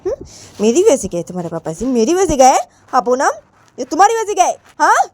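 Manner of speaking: pointed questions rising in pitch; challenging mother. Acted. Mother asking daughter who drove papa away, challenging. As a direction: build from measured to sharp accusation.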